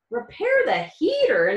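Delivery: mild disbelieving question; incredulous. Acted. Nutmeg doubting Tum Tum's plan, mildly incredulous. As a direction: ask it as if it can't be true. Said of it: "Nutmeg asked incredulously".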